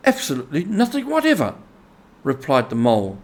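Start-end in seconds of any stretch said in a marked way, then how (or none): 0.1-1.6 quick, high, matter-of-fact retort; a hint of prim honesty; lightly voiced deadpan. A narrator's reading. Mole answering the rat, quick and prim. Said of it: "with perfect truthfulness".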